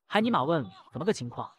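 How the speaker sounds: ordinary delivery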